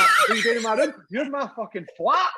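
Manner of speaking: loud, high mock outrage; re-enacted incredulous outburst; indignant retort. Retelling his astonished retort.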